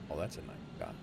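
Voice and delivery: hushed, breathy aside; whispering. Real, not acted. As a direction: whisper it to yourself. Admiring the view under breath.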